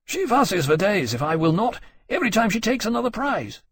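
plain reading voice

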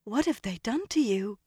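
high, shocked exclamation; exclaiming. A narrator's reading. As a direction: cry out in dismay. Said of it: "Elaine exclaimed".

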